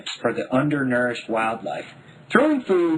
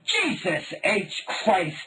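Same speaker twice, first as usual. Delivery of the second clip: yelled; 8 semitones higher, much slower than usual. A narrator's reading. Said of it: "Dad yelled".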